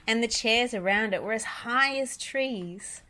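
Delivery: usual speaking voice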